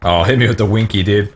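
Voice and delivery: ordinary talk, nothing marked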